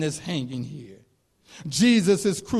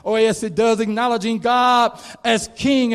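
normal talking voice

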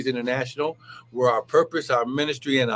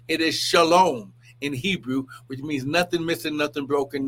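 usual speaking voice

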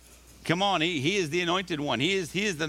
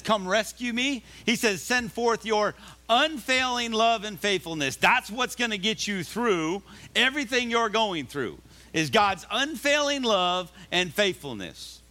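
higher, slow, swelling preacher's cry; fervent preaching; 7 semitones higher, slower than usual. Acted. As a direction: lift the promise up to the congregation. Preaching at full force.

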